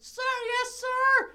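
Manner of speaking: very high, eager cadet reply; hammed-up squeaky salute; imitated military cadet. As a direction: sound off high like a mock recruit. Voices cadet answering the officer.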